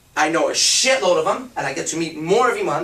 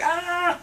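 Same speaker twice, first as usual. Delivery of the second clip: frustrated yell; 12 semitones higher, 3 dB quieter than usual. Retold or imitated. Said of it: "you're just gonna be at some point like".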